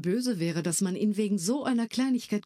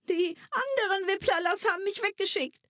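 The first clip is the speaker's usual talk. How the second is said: sobbing creature. Acted. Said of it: "schluchzte er".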